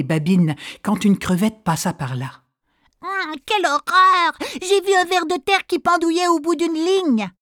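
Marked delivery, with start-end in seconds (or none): 3.0-7.3 a prissy shrimp; disgusted shrimp. Acted. (crevette dégoûtée)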